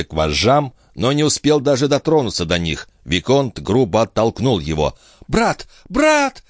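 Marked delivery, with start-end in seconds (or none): 5.3-6.4 two high cries, the second higher; crying out. A narrator's reading. Chon calls to her brother, crying out. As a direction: call out, climbing in pitch. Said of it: "кричала мадемуазель Шон".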